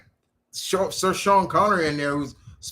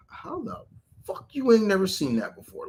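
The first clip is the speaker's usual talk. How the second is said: higher-pitched mock disbelief; incredulous; 5 semitones higher than usual. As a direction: react in retold disbelief. Reacting to first-time Terminator viewers.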